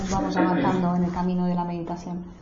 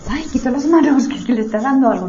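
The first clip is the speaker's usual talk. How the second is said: a mild imitation of an alarmed onlooker, higher and louder; mock alarm, rushing the second half; worried bystander; 5 semitones higher, 8 dB louder than usual. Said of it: "Hay quien dice".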